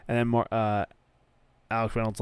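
usual speaking voice

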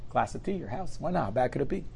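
ordinary delivery

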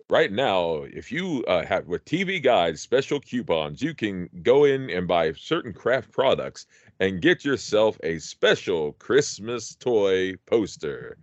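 slow, measured commercial pitch, imitating an announcer. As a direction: draw out the offer like a TV spot. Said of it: "he had this great voice, this, this T V voice".

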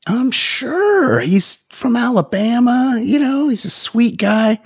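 high mocking sing-song; high sarcastic defence of the uncle; sarcastic mock sweetness. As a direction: sneer it in a falsely sweet high voice.

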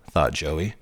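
ordinary delivery

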